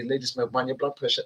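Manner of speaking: a light impression of women fussing; imitating the ladies. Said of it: "you ladies always say".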